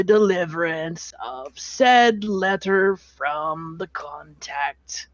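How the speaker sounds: put-on slow, dry ledger-reading of a weary old dwarf; old dwarf clerk reading a debt aloud to the party; bored clerk voice. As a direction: drag every word out like tedious paperwork. Lord Cass reads from ledger.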